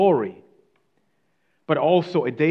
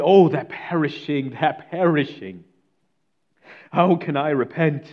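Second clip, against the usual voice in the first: louder, higher, distressed; anguished cry; 3 semitones higher, 5 dB louder than usual. Retold or imitated. Mister Thorpe to his friends after reading the verse, anguished and louder. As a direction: cry out as though stricken with guilt. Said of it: "he begins to cry out".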